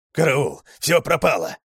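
loud, high alarm; panicked yelling; panicked cry. A narrator's reading. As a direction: shout it in sheer panic. Dog bursts in panicking.

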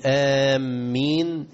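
"آمين" بمد واضح; قل "آمين" ممدودة; 1 drawn amen. Shown as a demonstration. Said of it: "عندما نقول". (آمين ممدودة)

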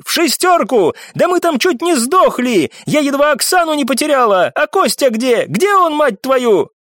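high-pitched raging tirade; furious shouting. A narrator's reading. Baroness blaming the man for the wrong rift, furious. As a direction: berate him in a fury. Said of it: "прокричала баронесса с яростью".

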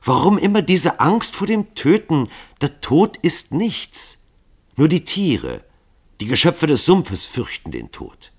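hoch und lauter, leidenschaftlich erregt. Acted. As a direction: mit steigender Erregung predigen. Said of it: "Rief Legouville erregt". (erregt und höher)

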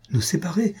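SÉPARER rising high in a startled question; shocked echo. Acted. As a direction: echo it back in disbelief, pitch climbing. Shocked echo of her words.

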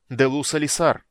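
loud ringing incantation; shouted spell. Acted. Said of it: "выкрикнул Рейстлин".

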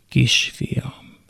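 soft unvoiced whisper of a mother. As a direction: breathe the words to her son. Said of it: "súgta".

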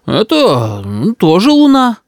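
flustered grandfather. Acted. Cornered grandfather makes excuse.

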